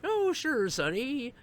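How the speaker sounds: put-on high, sweet little-old-lady voice. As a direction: squeak it out like a kindly grandma. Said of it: "She's like".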